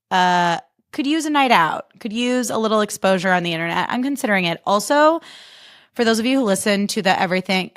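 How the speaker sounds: ordinary talk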